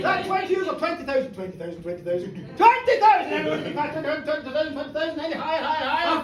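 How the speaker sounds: rapid repeated bid-calling; auctioneer patter. Acted.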